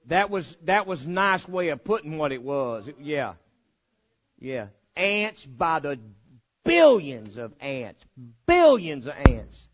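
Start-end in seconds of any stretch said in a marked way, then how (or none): 4.9-9.7 1 loud emphasis. Real, not acted. Preacher stressing hog pen ordeal.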